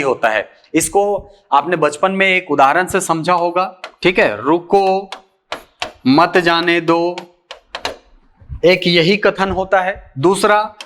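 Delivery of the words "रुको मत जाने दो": रुको alone, long pause, then मत जाने दो run together; pause after रुको. Shown as a demonstration. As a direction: stop clearly after रुको before saying मत जाने दो. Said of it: "रुको शब्द के बाद". (रुको के बाद विराम, फिर मत जाने दो)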